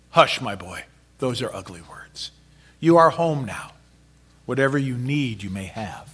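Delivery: soft, low hush sinking to a whisper. Acted. Mother hushing her son, low and soft. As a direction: soothe a frightened boy gently.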